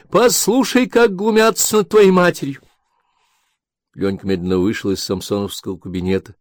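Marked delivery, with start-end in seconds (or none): 0.1-2.6 much higher, louder complaint; aggrieved mother. Acted. Mother complaining to son, high and loud. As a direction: play the victim loudly.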